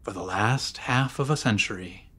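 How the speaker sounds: ordinary delivery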